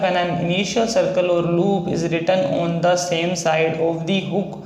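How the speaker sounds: reads the rule out very slowly, word by word; slow dictation pace, each word spaced out; slow, careful recitation of the rule; slow rule reading. Shown as a demonstration. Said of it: "बोला गया है".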